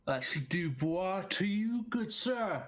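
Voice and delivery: Cockney, correcting the name Dubois as du-BWAH; Cockney accent. Acted. Biologist correcting the sheriff's pronunciation, Cockney accent. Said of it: "your Cockney is better than my Southern".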